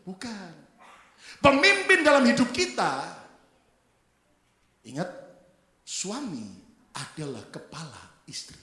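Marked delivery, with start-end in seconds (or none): 1.4-3.2 raised, high preacher's voice; emphatic preaching. Real, not acted. Preacher correcting the congregation on who leads, emphatic preaching. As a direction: announce it loudly to the whole church.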